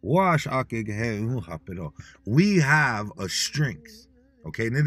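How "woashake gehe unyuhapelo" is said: lower, very slow, word by word; slow teaching phrase. Shown as a demonstration. Teacher leading listeners in a chant, slow and low. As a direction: Woashake gehe unyuhape drawn out slowly so they can repeat. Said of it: "Say it with me".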